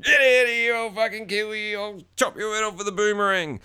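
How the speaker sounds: high hammed-up threatening rant; mock rage. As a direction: blow up in joking outrage. Joke rant at an Aussie hater.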